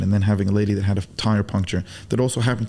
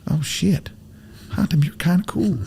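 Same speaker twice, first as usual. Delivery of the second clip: whispered quote; 7 semitones higher than usual. Retold or imitated. Texans' grudging goodbye quoted.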